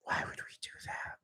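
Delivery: quick, hushed whisper; disbelieving whisper. Real, not acted. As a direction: mutter it fast under your breath.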